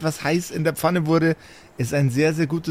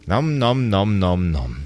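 mock munching; 5 semitones lower, slower than usual. GM imitates the heroes eating.

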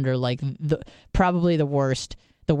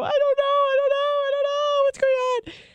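very high, wailing mock sob; asked if she's crying, imitating her own tearful wail. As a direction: whimper it like you're falling apart. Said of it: "Are you crying now?".

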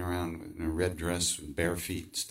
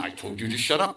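stern inner voice; 4 semitones higher than usual. Acted. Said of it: "the other part of me says".

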